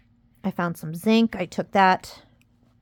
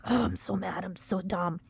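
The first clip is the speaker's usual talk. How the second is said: imitating muttered self-talk, much softer; mock the inner voice beating herself up; a soft, dejected imitation of self-criticism; self-blaming mutter; 8 dB quieter than usual. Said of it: "saying the things to themselves".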